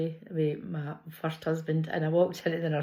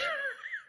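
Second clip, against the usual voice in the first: sudden high-pitched squeal of a laugh; high squeal of laughter; 7 dB quieter than usual. Real, not acted. Telling friends about her knickers falling down, squealing laugh. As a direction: let a shrill giggle escape, caught off guard.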